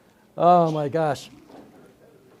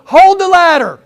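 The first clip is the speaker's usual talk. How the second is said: high-pitched raised voice, fed up; exasperated order; 7 semitones higher, 6 dB louder than usual. Acted. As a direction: belt out an order to an unhelpful helper.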